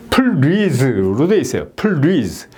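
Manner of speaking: slow "please" broken as peul-leez with a doubled L; mislearned pronunciation. Shown as a demonstration. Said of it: "L 발음이 두 개 들어가 있으면 이거는 R 발음이라는".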